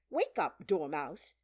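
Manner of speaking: loud, sharp wake-up call; crying out. A narrator's reading. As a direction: call out to rouse a sleeper. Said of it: "they both cried".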